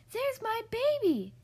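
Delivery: high, loud storybook shout; shouted alarm. A narrator's reading. Mother spots her baby in the road, alarmed shout. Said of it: "shouted Missus Clutterbuck".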